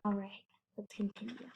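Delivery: quiet whispered aside. Real, not acted. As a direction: hush it to the listener. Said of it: "aside to child".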